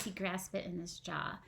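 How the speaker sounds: ordinary delivery, nothing marked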